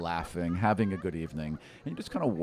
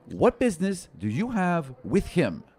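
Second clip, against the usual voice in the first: raised, louder sharp question; a protective mistress confronting a stranger; Prisma; 9 semitones higher, 5 dB louder than usual. Retold or imitated. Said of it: "and she's like".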